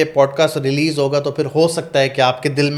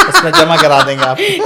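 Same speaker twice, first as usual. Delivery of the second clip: laughing; 12 semitones higher, 5 dB louder than usual. Real, not acted. Teasing her about a madhouse.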